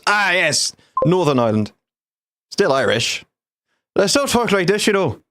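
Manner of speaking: pitched well up, hammed-up know-it-all; lightly mocking a smug Irishman, voice high; mock Northern Irish person. Mocking a smug Northern Irishman to his stream, in a high hammed-up voice. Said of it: "who turns around and says".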